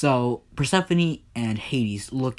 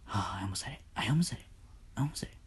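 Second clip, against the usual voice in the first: hushed, much softer self-check; whispered aside; 2 semitones lower, 11 dB quieter, much faster than usual. Real, not acted. Storyteller catches himself before a slip, real whisper. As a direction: whisper it to yourself in relief.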